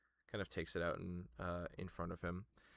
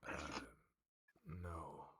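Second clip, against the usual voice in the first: low quiet voice; 5 semitones lower, 10 dB quieter than usual. Acted. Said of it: "watch as the Herald goes".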